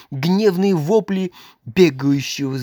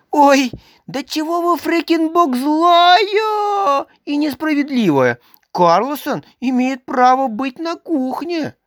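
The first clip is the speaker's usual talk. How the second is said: much higher, louder tearful wail; sobbing little boy; crying protest; 12 semitones higher, 3 dB louder than usual. A narrator's reading. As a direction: cry out at the unfairness. Boy pleads angry in tears.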